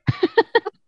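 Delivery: very high squeal-laugh; high giggle. Real, not acted. Host joking dead inside, squeal laugh.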